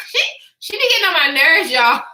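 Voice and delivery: laughing while talking. Real, not acted. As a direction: giggle through the line. Laughing at chat comment.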